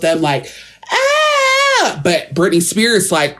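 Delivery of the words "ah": very high, sustained squeal; high mock scream; imitated scream. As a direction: squeal like an excited crowd. Imitates stars screaming in promo.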